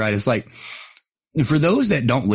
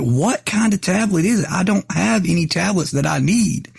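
exasperated repeat; 8 semitones higher than usual. Retold or imitated. [name] pressing the VA caller again, higher and exasperated. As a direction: push it up like you've asked ten times. We keep talking and talking.

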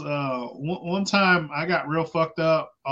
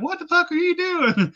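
much higher, shocked mother-in-law screech; girlfriend's mom yelling; 13 semitones higher than usual. Retold or imitated. Girlfriend's mom catching him naked in the mud, high shocked yell. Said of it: "she's like".

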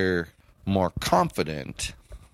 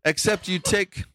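ordinary delivery

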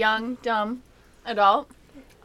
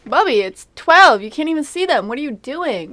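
calling out; 5 semitones higher, 12 dB louder than usual. Real, not acted. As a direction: call over to him, exasperated. Scolding someone setting off fireworks.

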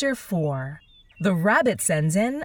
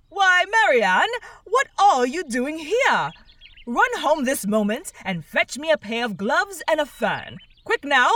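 louder, higher angry rabbit order; 6 semitones higher, 4 dB louder than usual. A narrator's reading. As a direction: bark orders at the maid. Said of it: "in an angry tone".

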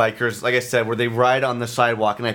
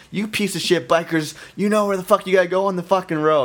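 fast, higher-pitched mock yelling; quick indignant rant, only half raised; retold angry yell; 6 semitones higher than usual. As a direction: imitate a heated street argument, words tumbling out. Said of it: "they're yelling at each other".

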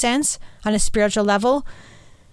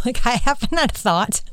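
laughing; 3 semitones higher than usual. Real, not acted. Laughing at her own remark.

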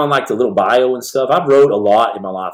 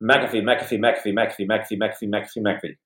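tongue twister; 2 semitones lower, 7 dB quieter than usual. Shown as a demonstration. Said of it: "Try to say this in a row".

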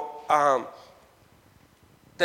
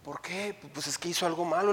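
ordinary talk

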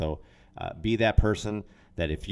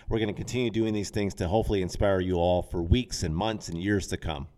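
ordinary talk, nothing marked

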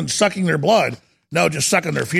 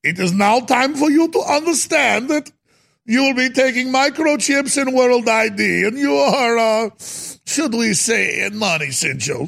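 higher, half-shouted mock German villain accent; 5 semitones higher than usual. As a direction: sneer it in a hammed-up German accent. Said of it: "I do more of a Russian accent than a German".

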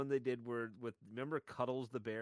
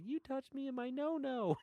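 much higher, slow squeaky teddy bear voice; voicing the bear; 14 semitones higher than usual. Telling a friend about the parody ad, squeaky bear voice. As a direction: whimper it like a traumatized stuffed bear.

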